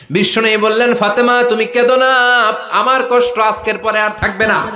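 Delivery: high, loud chanted consolation; impassioned preaching. Acted. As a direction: call out the Prophet's words from the pulpit. Preaches the Prophet's words.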